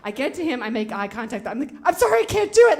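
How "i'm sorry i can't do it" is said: much higher and louder, blurted; desperate cry. Retold or imitated. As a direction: blurt it out as you bolt. Fleeing the priest's bedroom.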